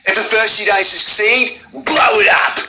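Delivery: high-pitched yell climbing on the last words; bombastic Junkrat. Acted. Second try at the game voice line, bombastic. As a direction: bombastic bomber shouting before the blast. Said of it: "I'll just tell you it's bombastic".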